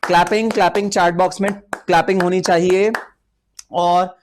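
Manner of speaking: rally the class to clap in the chat; excited call for applause. Real, not acted. Teacher rouses live students to clap in the chat, excited.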